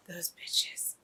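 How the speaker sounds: unvoiced, low whispered "bitches"; real bitter whisper; whispered curse. Real, not acted. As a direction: spit it out under your breath. Cursing the villains.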